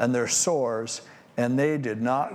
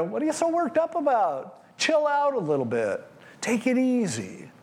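mock dismissive brush-off; 8 semitones higher than usual. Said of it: "they go".

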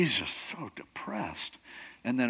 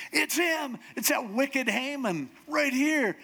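high, emphatic pointing-out of the villain; dramatic accusation; 12 semitones higher, slower than usual. Acted. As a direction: expose him in acted alarm. Esther accuses Haman before the king.

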